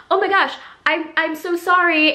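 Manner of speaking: flustered neighbor. Retold or imitated. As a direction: gush sorry like you've been caught. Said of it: "she was like".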